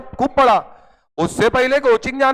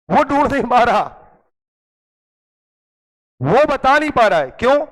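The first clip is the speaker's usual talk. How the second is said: normal talking voice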